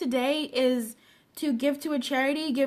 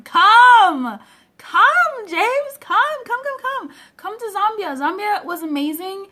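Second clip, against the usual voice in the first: much higher and louder, waving him over; excited calling out; 6 semitones higher, 9 dB louder, slower than usual. Pretend. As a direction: beckon him eagerly across the distance. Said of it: "I'm acting like I'm in Zambia".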